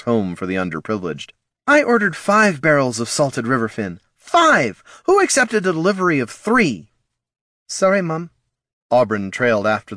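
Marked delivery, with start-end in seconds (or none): 1.7-6.9 angry shout. A narrator's reading. Angry boss scolding staff. 7.7-8.3 meek apology. A narrator's reading. Girl apologising to boss.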